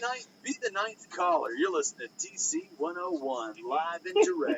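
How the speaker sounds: radio contest promo. Pretend. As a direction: shout the call-in contest like a DJ. Parody DJ call-in contest.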